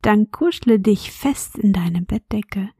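bedtime whisper. Acted. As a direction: whisper gently as if tucking a child in. Host whispers bedtime intro.

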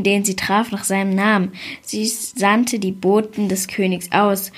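ordinary delivery, nothing marked

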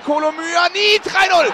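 high rising goal call; screaming goal commentary. Real, not acted. Third goal called.

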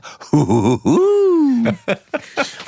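very high bouncy cartoon laugh; giggling cartoon tiger; Tigger laugh. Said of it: "euer Tigger".

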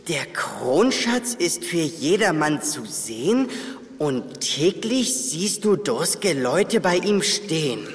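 langsam, feierlich vorgelesen; Rätsel vorlesen. Acted. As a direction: den Rätseltext bedächtig vortragen. Reads riddle aloud. (langsam und tief das Rätsel vorlesen)

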